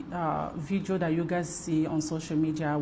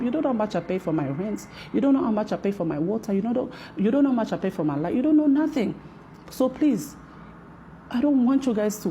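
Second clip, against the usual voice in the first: rising indignation; 4 semitones higher, faster than usual. Real, not acted.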